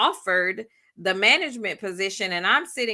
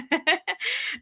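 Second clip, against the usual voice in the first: short laugh; 9 semitones higher, 3 dB quieter than usual. Real, not acted. Reacting to coworker's claim.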